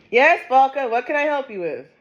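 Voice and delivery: high sing-song voice for the pet. Real, not acted. Owner cooing at her pet Falca, high baby voice.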